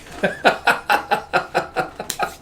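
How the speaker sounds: long, high breathy giggle; helpless run of little laughs; giggling. Real, not acted. Laughs at character's line.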